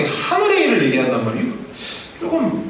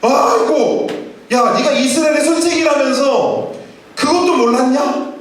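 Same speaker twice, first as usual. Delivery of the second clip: an exasperated teacher caught off guard; Jesus, amazed, chides Nicodemus; surprised Jesus; 8 semitones higher than usual. Retold or imitated. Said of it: "예수님이 더 깜짝 놀라세요".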